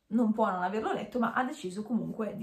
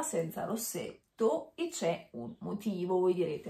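usual speaking voice

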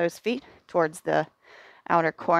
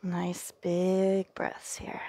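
whisper; 6 dB quieter, much slower than usual. Real, not acted. Calm cue during forward fold.

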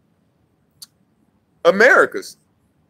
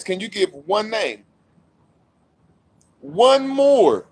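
normal talking voice

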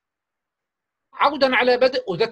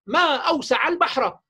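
model sentence for pupils to copy; 4 semitones higher than usual. Shown as a demonstration. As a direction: say it like a model for a child to imitate. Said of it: "يحفظها منك".

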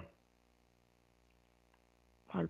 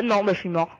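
normal talking voice

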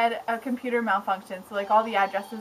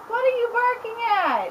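pet talk; 10 semitones higher than usual. Real, not acted. Talking to the barking pet.

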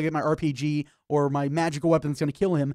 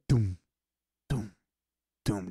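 low, slow mock doom thuds; slow footsteps; 10 semitones lower, 4 dB quieter, slower than usual. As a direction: drop low for heavy steps. Imitating villain footsteps.

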